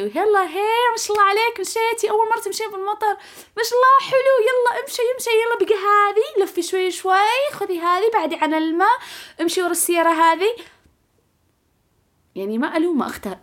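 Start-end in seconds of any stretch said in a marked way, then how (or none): 0.0-10.5 very high, sugary singsong encouragement; self-cheering like to a child. Retold or imitated. Nervous driver cheers herself through rain, high-pitched child-coaxing voice. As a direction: act out babying yourself through a scary drive. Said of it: "وأنا أصفق لنفسي وأشجع نفسي".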